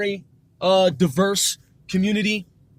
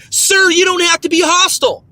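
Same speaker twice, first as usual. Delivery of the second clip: high, loud scolding agent; raised, accusatory mock voice; mock phone agent; 7 semitones higher, 6 dB louder than usual. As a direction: imitate an indignant customer service rep. Acts out phone agent scolding him.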